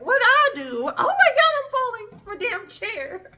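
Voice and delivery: exaggerated viewer imitation, falling off the chair; mock shocked viewer. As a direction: squeal like an offended viewer. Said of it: "You guys probably are like".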